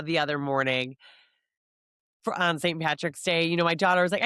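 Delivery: usual speaking voice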